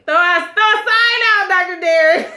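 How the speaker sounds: high, loud pleading call out; laughing plea. Real, not acted. Viewer calling to Doctor Derek, high and loud plea. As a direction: beg him to signal for help, half laughing.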